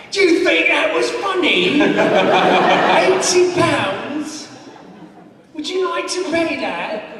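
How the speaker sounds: high, loud, indignant British copper; London bobby. Retold or imitated. Bobby rounding on a smiling tourist, high indignant scolding. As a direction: drag out the fine as a mock-British threat. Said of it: "he was smiling at me".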